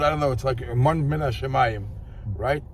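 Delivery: ordinary delivery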